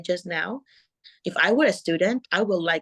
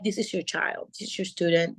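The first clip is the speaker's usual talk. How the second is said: normal talking voice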